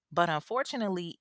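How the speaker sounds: ordinary delivery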